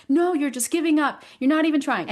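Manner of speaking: imitating the son's accusing retort; mad kid. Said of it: "And he was so mad".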